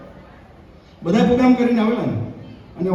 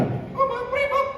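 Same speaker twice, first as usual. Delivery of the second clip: very high, slow put-on voice; squeaky mock listener; 6 dB quieter, slower than usual. Comedian voices a heckler asking, high and slow. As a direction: pipe it up slow like a cartoon kid.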